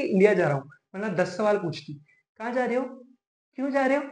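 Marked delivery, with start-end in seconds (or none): 2.4-4.0 soft, higher-pitched wifely questioning; imitated wife. Husband mimics wife questioning his trip, soft higher voice. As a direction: mimic a prying wife in a small voice.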